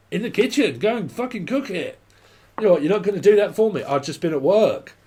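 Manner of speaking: acted domestic squabble; irritated row. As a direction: argue back sharply over dinner. Acts parent-kid row over tea.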